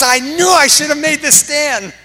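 high, loud retold cry; mock anguished outburst. Bible reader regretting he didn't take a stand, high mock outburst. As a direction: wail the regret at full voice, hammed-up. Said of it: "He goes".

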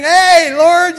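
greeting the Lord with a big, high, loud call; joyful greeting. Pretend. Arriving in heaven, he greets the Lord with joyful loud delight.

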